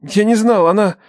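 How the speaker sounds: high, distraught, broken phrases; struggling for words. A narrator's reading. Son explains himself to his father, distraught and faltering. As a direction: falter through an upset explanation. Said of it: "Слова давались с трудом".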